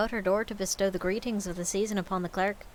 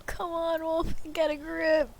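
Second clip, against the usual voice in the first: ordinary delivery, nothing marked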